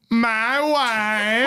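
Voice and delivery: high, drawn-out foreign catchphrase voice; Borat. Acted. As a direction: put on the thick Borat accent and stretch it. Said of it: "I wanna sit on this Borat".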